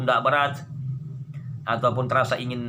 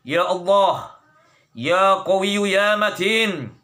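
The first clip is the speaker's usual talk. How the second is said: fervent prayer; 3 semitones higher, 4 dB louder, slower than usual. Real, not acted. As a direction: cry out each name slowly, pleading. Fervent invocation of God's names.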